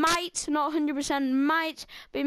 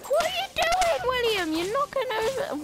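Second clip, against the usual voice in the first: high-pitched, alarmed scolding; exasperated outcry; 10 semitones higher than usual. Real, not acted. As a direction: cry out in protest at a co-player. Scolds co-player William mid-game.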